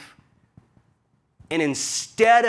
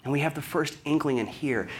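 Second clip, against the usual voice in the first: normal talking voice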